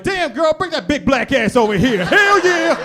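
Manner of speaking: hyping himself up in the mirror; mock self hype. Comic talking to his reflection, cocky loud catcall.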